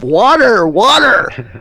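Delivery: loud, high-pitched cry for water; retold scream. Storyteller acting the man hit by the poker, screaming. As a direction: yell it out as the scalded man in the tale. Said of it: "And he screams".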